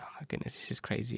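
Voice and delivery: quiet stunned wonder; awed hush. Retold or imitated. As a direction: hush it in disbelief. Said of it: "I was like".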